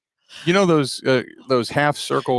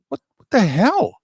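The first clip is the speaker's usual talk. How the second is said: shocked clerk; 7 semitones higher than usual. Retold or imitated. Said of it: "she looked at me and she went".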